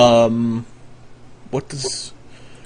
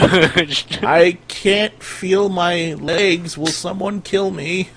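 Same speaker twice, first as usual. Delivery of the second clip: slow, drawn-out, weakened plea; pleading wizard; 3 semitones higher, 3 dB quieter, much slower than usual. Acted. Crippled wizard begs someone to kill him, slow and pleading. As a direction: drag the words out helplessly.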